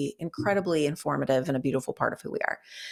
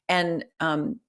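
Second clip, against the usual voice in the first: usual speaking voice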